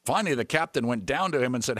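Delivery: ordinary delivery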